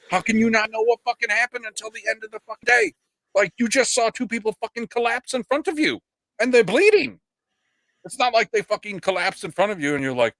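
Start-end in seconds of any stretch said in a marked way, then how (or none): 0.1-7.2 high-pitched exasperated scolding; outraged and high, berating him; incredulous rant. Pretend. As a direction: rail at him in disbelief, voice up high. Rant scolding Baldwin in absence.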